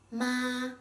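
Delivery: MA held flat and high; first tone. Shown as a demonstration. Teacher introduces the first tone, MA high and flat. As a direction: say MA on a level, high pitch. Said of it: "with a flat and high-pitched tone".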